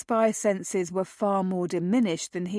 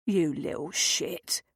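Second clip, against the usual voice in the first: slow, lower growled insult; 3 semitones lower, 3 dB quieter, slower than usual. A narrator's reading. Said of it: "Ludlow growled".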